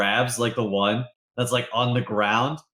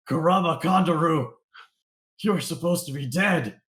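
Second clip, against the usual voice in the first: mild shocked boss; 5 semitones higher than usual. Acted. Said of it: "he's like".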